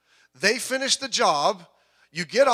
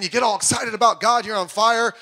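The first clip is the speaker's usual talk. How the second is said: normal talking voice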